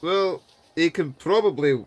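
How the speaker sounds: normal talking voice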